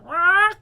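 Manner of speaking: high loud squawk; high, loud parrot screech; startled parrot squawk. Acted. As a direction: squawk as you nearly fall. Parrot nearly falls off.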